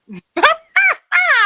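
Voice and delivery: very high, loud burst of laughter. Real, not acted. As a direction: crack up hard, full laugh. Host cracks up at comment.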